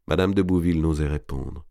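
usual speaking voice

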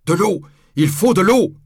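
high, loud cry for help; urgent shout. A narrator's reading. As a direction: shout it out urgently over a blaze. Guillaume calls for water amid fire.

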